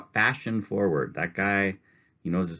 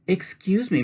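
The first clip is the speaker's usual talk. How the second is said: much higher, polite anxious voice; Mrs. Prim's voice; 13 semitones higher, 6 dB louder than usual. Acted. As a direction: catch a salesman's attention. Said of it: "Missus Prim said".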